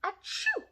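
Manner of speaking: loud, high demo sneeze into the elbow; playful demo sneeze; big sneeze. Shown as a demonstration. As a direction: sneeze it out for the kids. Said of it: "like this".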